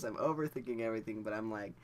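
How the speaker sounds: ordinary talk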